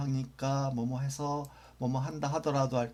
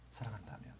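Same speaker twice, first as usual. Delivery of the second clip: whisper; 3 semitones lower, 12 dB quieter, faster than usual. Shown as a demonstration. Teacher repeats conditional translation.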